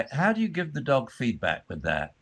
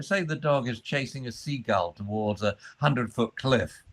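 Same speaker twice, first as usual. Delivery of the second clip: ordinary delivery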